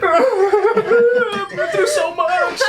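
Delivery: high-pitched, drawn-out fake wail; hammed-up tearful whine, very slow; mock sobbing. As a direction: laugh through an exaggerated crying voice. Acts out crying to sad music.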